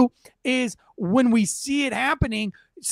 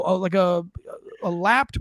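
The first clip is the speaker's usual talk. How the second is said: usual speaking voice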